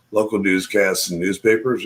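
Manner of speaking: ordinary talk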